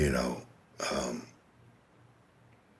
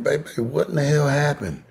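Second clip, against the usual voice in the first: higher, louder alarmed question; shocked question; 11 semitones higher, 4 dB louder than usual. Retold or imitated. Nephew seeing bullet holes at his aunt's house, alarmed and higher. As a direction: ask it high and startled. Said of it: "I'm like".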